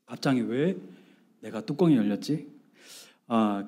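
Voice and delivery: normal talking voice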